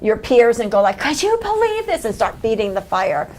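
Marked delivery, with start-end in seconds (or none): 1.0-2.0 very high-pitched incredulous question; mock gossipy outrage. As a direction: mimic someone griping to peers, voice way up. Mimics griping to peers.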